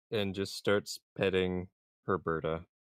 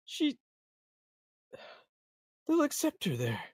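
much higher, teary, on the verge of crying; lightly acted tearful whine; tearful pleading; 4 dB louder than usual. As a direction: plead through tears for the mule. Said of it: "he's tearing up".

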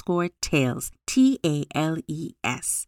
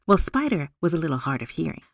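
ordinary delivery

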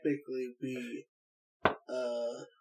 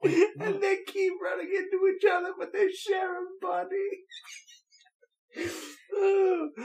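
high, slow words through laughter; laughing hard. Real, not acted. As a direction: explain it while cracking up. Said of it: "I might be laughing a little hard".

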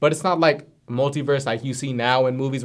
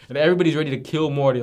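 ordinary talk, nothing marked

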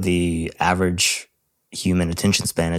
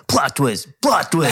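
higher, hyped video game commentator; fighting game announcer; 8 semitones higher than usual. Retold or imitated. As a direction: call "plot twist" like an arena announcer. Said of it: "sounded like a video game commentator".

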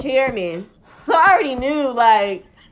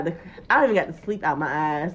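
ordinary talk

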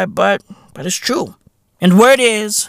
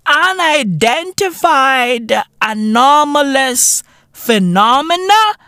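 mock pompous; 6 semitones higher than usual. Mocking the pompous name.

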